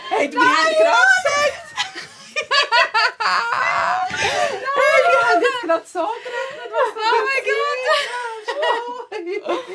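overjoyed, laughing through tears; laughing and crying. Real, not acted. Overwhelmed greeting of returned friend. (lachend und weinend vor Freude, viel höher)

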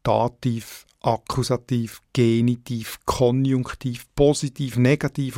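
Dativ, Akkusativ, Genitiv, Konjunktiv, Positiv, Negativ as a list, each accented on its opening syllable; stress on first syllable. Shown as a demonstration. Editor listing examples, first syllable stressed in Dativ, Akkusativ, Genitiv, Konjunktiv, Positiv, Negativ. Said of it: "auf der ersten Silbe betont".

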